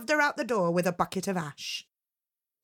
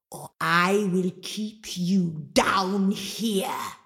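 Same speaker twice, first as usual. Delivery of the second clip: slow, drawn-out threat; menacing ogress; much slower than usual. Acted. Mama Draga threatening Angelina down the well, slow and menacing. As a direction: let each word hang.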